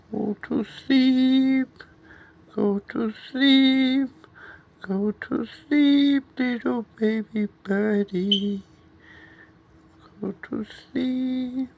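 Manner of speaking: high, slow, soothing lullaby. Real, not acted. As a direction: lull the bird to sleep, slow and high. Lullaby to bird.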